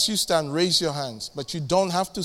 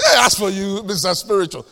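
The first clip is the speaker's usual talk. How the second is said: mocking jibe; 5 dB louder than usual.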